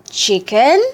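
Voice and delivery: ordinary delivery, nothing marked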